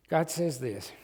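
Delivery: normal talking voice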